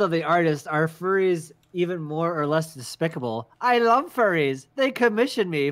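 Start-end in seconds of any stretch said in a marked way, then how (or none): 3.6-5.7 villain voice. Pretend. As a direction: gloat like a grand stage villain. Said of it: "you have a villain voice".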